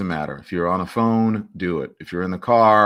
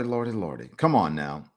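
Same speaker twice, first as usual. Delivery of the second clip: usual speaking voice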